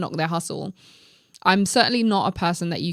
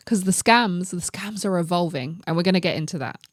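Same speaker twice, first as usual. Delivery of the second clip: ordinary talk, nothing marked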